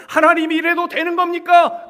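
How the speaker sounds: very loud, high cry to God; full-force imploring shout; acted wailing cry. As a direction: cry out in anguished protest. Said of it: "울부짖으면서".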